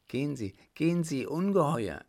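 high, fast cry of revulsion; frightened cry. Acted. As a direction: cry out in fear and disgust. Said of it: "rief sie".

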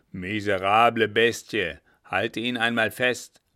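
loud, forceful cursing; angry command. Acted. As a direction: curse the dog and order it held. Captain curses dog, gives order. (wütender, lauter Befehl)

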